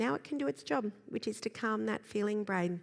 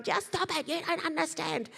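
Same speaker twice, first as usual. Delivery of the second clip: put-on frustrated child; 8 semitones higher than usual. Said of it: "they're going".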